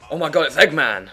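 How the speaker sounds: usual speaking voice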